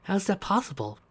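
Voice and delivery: quietly astonished; amazed. A narrator's reading. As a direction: ask in soft wonder. Sally amazed at ring's power.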